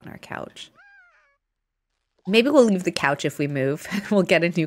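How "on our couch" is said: hushed aside under the breath; whisper. Real, not acted. Murmuring to herself over the scratched couch, whispered. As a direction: breathe it out like a secret. Aside while watching the game.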